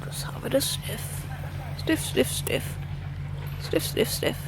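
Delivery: hushed whispered commentary on the dog; whispering. Real, not acted. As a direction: narrate the sniffing in a whisper.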